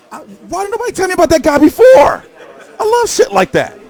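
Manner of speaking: high, loud, laughing shout. Real, not acted. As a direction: laugh it out, thrilled. Laughing at funny clip.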